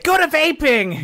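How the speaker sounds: high, slow mock nag; mock advice. Nagging the singer to vape, high and slow. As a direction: nag him like a fussy parent.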